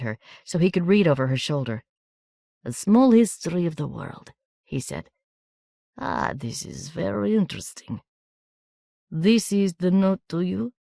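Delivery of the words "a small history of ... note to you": put-on measured, knowing foreign boy; foreign boy, lightly voiced. A narrator's reading. Markasev telling Miranda he knows her family, lightly accented.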